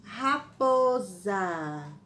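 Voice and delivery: raposa slowly, every syllable drawn out: ra-po-sa. Shown as a demonstration. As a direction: spell out raposa slowly for dictation. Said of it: "rapo-, za".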